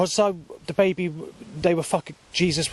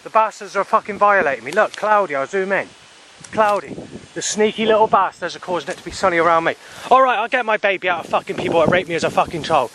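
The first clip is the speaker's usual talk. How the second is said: angry rant; 4 semitones higher than usual. Real, not acted. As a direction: spit the insults out, furious. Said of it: "I'm literally getting pissed off".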